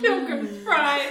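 ordinary talk, nothing marked

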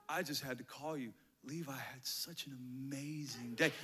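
soft praise. Acted. Principal phoning the parents about Levi, soft praise. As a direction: gently report good news, hushed.

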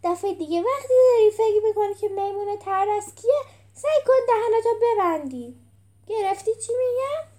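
high, loud, emphatic telling-off; scolding character. A narrator's reading. Character scolding someone in story.

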